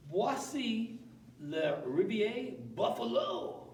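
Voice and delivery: slow, hammed-up French flourish; theatrical French voice; mock French announcement. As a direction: present it like a voyageur guide.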